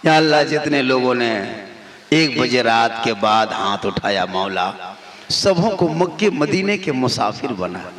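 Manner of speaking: very low, measured supplication; supplicating prayer. Real, not acted. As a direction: pray in a deep, hushed-down voice.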